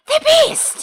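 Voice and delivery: very high, loud melodramatic outcry; red-faced lord's shrieked accusation; theatrical gasp. Acted. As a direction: gasp it out in hammed-up horror. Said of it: "he gasped".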